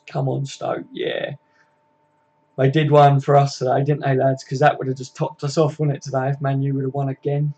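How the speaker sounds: ordinary delivery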